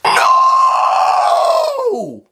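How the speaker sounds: very high, loud shriek; dying alien scream. Acted. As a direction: scream as it is destroyed. Nestene explodes.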